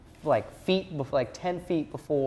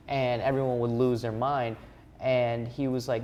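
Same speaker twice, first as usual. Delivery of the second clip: normal talking voice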